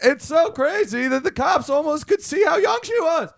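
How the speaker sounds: high, stretched-out punchline yell; mock stand-up punchline delivery; hammed-up comedian shout. Booming comedian joke-punchline bit.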